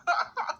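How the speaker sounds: short high laugh; amused laugh. Real, not acted. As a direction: burst into a brief laugh. Cracks up at own remark.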